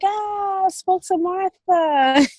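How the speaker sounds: high, slow gushing quote; excited quote. Retold or imitated. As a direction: gush it out, delighted. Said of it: "I'm like".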